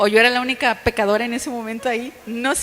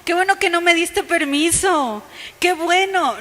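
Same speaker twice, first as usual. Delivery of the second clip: high, loud, exaggerated gratitude; mock delight; 5 semitones higher, 4 dB louder than usual. Believer pretending to welcome a refusal from authority, mock delight. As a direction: gush with pretend joy at being refused. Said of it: "no es como que".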